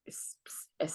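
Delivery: normal talking voice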